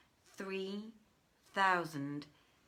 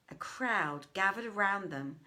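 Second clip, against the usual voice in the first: ordinary delivery, nothing marked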